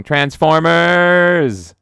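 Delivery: slow, loud, high announcer; hammed-up trailer call. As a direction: boom the title. Movie title call.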